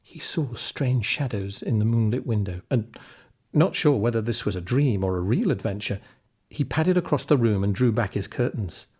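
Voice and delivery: ordinary delivery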